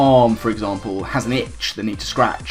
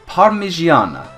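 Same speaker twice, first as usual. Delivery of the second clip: slow, higher-pitched bad Italian accent; 6 semitones higher than usual. Host announces Executor's nickname, in a badly done Italian accent. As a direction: draw it out in a poor Italian accent. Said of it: "I have a very bad Italian accent".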